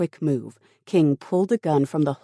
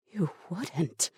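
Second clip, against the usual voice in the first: hushed, higher-pitched disbelief; horrified gasp; 4 semitones higher, 4 dB quieter than usual. A narrator's reading. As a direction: horror caught softly in the throat. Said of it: "said with a horrified gasp".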